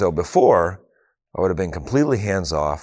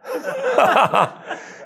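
high-pitched laugh. Real, not acted. Laughs after retelling son's line.

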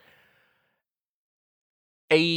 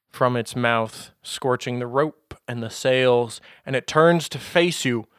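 ordinary talk

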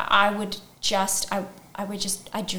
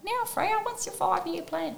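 high prim mum voice; imitating mum; 11 semitones higher, 3 dB quieter than usual. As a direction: put on a high posh mother voice. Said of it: "She doesn't, she doesn't sound like that".

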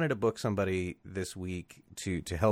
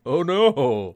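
mock dismay; 6 semitones higher, 8 dB louder than usual. Acted reaction to smoky sky.

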